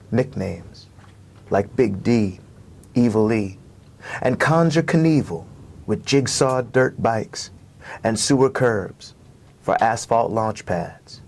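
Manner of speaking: slow, measured reading with long pauses; poem reading. Acted. Poet performing his poem for the host, slow and rhythmic. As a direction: let each image land on its own.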